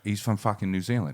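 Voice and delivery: put-on low, soft Australian accent. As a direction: imitate a laconic Australian mate. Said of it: "with an Australian fella".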